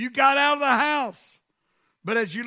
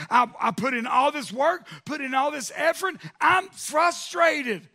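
higher, exasperated preacher's paraphrase; frustrated; 4 semitones higher, 4 dB louder than usual. Acted. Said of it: "doesn't sound like frustration".

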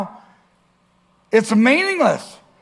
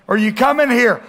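ordinary delivery

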